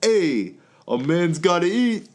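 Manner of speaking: high, drawn-out cocky excuse; comic self-justifying husband. Acted. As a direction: stretch it out as a hammed-up sitcom husband. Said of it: "And I go".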